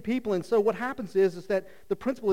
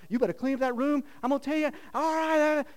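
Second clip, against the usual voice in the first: mock scolding parent; 7 semitones higher than usual. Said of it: "you're like".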